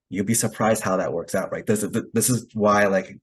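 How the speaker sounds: normal talking voice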